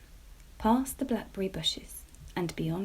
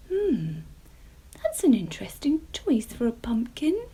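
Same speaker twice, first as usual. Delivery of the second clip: thoughtful; 5 semitones higher, 5 dB louder than usual. A narrator's reading. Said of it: "she said thoughtfully".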